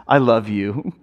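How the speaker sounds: low, tender "I love you"; heartfelt. Acted. Husband who stops to face his wife, heartfelt. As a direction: say it with thought, meaning it. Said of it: "to give it thought".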